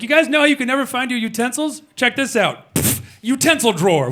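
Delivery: high, eager show-and-tell; excited Julie. Retold or imitated. Julie showing the roommates her drawer, high and eager. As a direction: present it like a magic trick. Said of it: "She was like".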